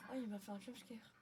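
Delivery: ordinary talk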